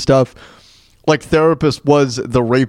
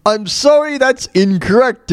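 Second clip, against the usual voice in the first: much higher, slow prissy host; imitating Trebek; 9 semitones higher, slower than usual. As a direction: correct him primly. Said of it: "Trebek goes".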